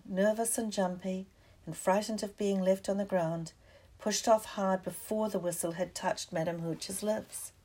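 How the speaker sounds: ordinary delivery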